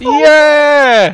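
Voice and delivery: loud high victory yell; triumphant shout. Real, not acted. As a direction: bellow it in triumph.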